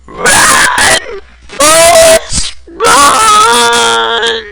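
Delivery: very high, loud screech of Run, Forrest, run!; a piercing, very high shout to annoy; shrieking shout. Pretend. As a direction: screech it as high as you can. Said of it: "one of my annoying sounds".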